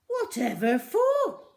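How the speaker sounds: high, loud, slow astonishment; loud slow amazed query; astonished shopkeeper. A narrator's reading. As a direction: gasp out the question. Raj voiced.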